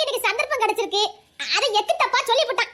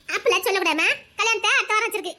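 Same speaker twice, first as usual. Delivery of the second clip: ordinary talk, nothing marked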